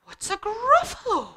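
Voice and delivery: high-pitched owl question; storybook owl, high and baffled; puzzled owl. Acted. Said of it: "And the owl said".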